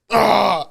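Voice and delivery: loud burst of a wail; mock crying wail. Groom retelling being about to cry, mock wail. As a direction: re-enact a sudden urge to cry, loudly. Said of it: "instantly wanted to cry".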